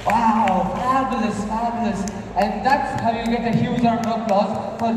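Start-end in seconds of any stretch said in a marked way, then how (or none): none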